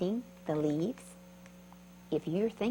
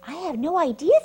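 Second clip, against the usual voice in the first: excited caterpillar; 9 semitones higher, 8 dB louder, faster than usual. Acted. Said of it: "said the creepy crawly bug".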